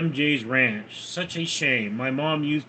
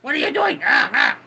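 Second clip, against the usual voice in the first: real high-pitched shout; sudden high, loud telling-off; sharp scolding shout; 9 semitones higher, 4 dB louder than usual. Real, not acted. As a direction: shout to stop the dog right now. Scolds a dog mid-stream.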